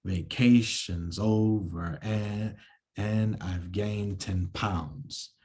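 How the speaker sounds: slow, stressing every second syllable; slow metrical scansion; iambic reading. Shown as a demonstration. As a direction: read it in da-dum rhythm. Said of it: "an example of this in actual words".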